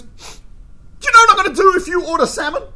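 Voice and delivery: high, loud, menacing question; angry threat. Retold or imitated. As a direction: threaten him over his order. Waiter threatens diner.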